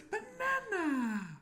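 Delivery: put-on high, slow crying wail of a toddler. Dad imitating his toddler son's crying banana. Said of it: "he goes".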